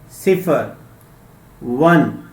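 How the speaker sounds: usual speaking voice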